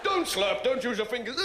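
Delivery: crisp disapproving governess; disapproving parent. Retold or imitated. Parents scolding at the table.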